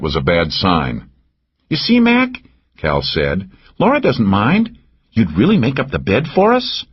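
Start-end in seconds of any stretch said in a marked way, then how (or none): 1.7-2.4 higher, teasing woman's voice; Cal's voice. A narrator's reading. Narrator voices Cal. 3.8-6.8 higher, teasing woman's voice; Cal's voice. A narrator's reading. Narrator voices Cal.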